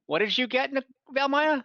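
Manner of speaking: ordinary talk, nothing marked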